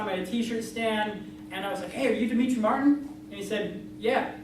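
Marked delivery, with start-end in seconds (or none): none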